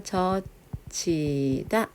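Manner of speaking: 젖히다 slowly as 저, 치, 다; demonstrated aspiration. Shown as a demonstration. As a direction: draw out 젖히다 syllable by syllable. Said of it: "이렇게".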